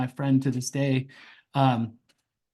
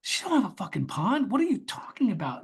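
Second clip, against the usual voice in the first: disbelieving mutter; 6 semitones higher than usual. Retold or imitated. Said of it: "And I'm like".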